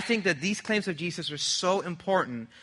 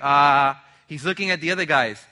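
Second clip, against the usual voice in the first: ordinary talk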